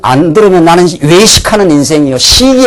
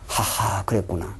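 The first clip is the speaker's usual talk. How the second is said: quiet realization; 8 semitones lower, 17 dB quieter than usual. Retold or imitated. Voices the moment of realization.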